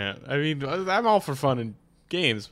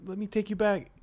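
Italian family; 7 semitones higher, 4 dB quieter, slower than usual. Retold or imitated. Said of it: "My family was like".

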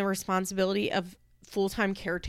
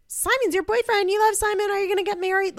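put-on excited child; 12 semitones higher, 3 dB louder, faster than usual. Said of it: "she is like".

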